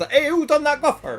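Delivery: high, loud yokel shout through laughter; hammed-up West Country yokel; West Country Humpty. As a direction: bellow it like a country bumpkin. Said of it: "That's what, that's from".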